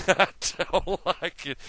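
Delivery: amused, giggly repeat of I don't like it; laughing. Real, not acted. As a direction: say it again with a chuckle in it.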